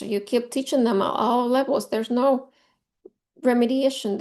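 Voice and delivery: ordinary delivery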